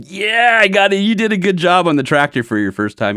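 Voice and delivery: thrilled to hear the uncle's rare praise; proud excitement. Retold or imitated. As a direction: crow it like you just won something.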